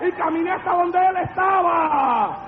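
high-pitched shouted testimony; full-force preaching. Real, not acted. Preacher telling congregation how the demon left, full-force shouted preaching.

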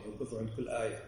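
ordinary talk

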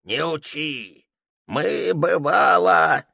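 put-on slow, gummy old soldier scoff; toothless old veteran. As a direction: brush it off like a toothless old man. Veteran waves off warning again.

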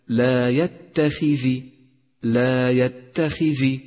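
يتخذ slow, with a kasra on ذ; careful recitation. Shown as a demonstration. Teacher warning the class, reciting slowly. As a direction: stretch يتخذ, i after the ذ. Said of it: "احذر من اللحن عند قراءة حرف الذال".

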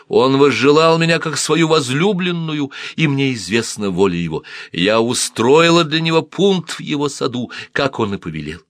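high, loud, defiant declaration. Acted. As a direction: throw down a challenge. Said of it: "в нём зазвучал вызов".

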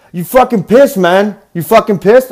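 rowdy English bro challenging a mate; pushy English bloke impression; English bro accent. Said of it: "broy dudes from, like, England".

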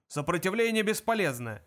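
moderately loud military bark; guard's shouting. A narrator's reading. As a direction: shout it mechanically over everyone. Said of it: "крики охранника".

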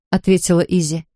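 ordinary delivery

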